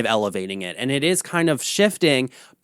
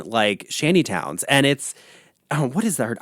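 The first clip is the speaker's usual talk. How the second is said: ordinary talk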